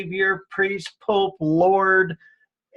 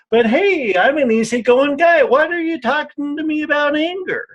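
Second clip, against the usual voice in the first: higher and louder, a put-on easygoing guy brushing anger off; mock breezy denial; 8 semitones higher, 4 dB louder than usual. Man mocking his younger self's denial, higher and louder. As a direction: play the guy who swears he's not angry, rising into disbelief.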